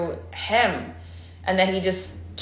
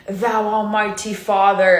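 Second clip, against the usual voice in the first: formal prayer voice. Retold or imitated. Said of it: "So it doesn't have to be".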